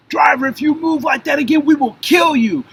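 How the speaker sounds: high, loud threatening shout; armed officer shouting a kill warning; police shout. Retold or imitated. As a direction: scream the threat, gun drawn.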